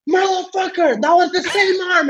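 high-pitched mock-angry protest; acted indignant yell without much volume; pissed-off retold outburst. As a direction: imitate an outraged training partner, voice jumping up high. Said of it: "he just got really pissed".

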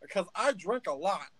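a little gnome spiralling into a crisis; panicky gnome Stitch. Acted. Gnome Stitch freaks out that a stranger guessed his name. Stitch talks with tavern patrons.

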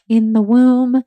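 ordinary talk

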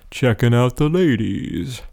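slow, dopey cartoon-teen voice. Shown as a demonstration. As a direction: say it like a slack-jawed dope. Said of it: "I'm trying to use kind of a dopey voice here".

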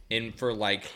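ordinary talk, nothing marked

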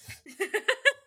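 high, loud burst of laughter. Real, not acted. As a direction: howl with laughter. Laughing at Mothman in cowboy hat.

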